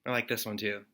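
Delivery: usual speaking voice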